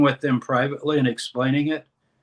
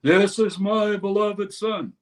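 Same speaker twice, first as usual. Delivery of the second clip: higher, slow, booming announcement; God over a loudspeaker; 7 semitones higher, slower than usual. Retold or imitated. God announcing his son in Matthew: slow booming broadcast. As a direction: proclaim it like a voice from heaven over a PA.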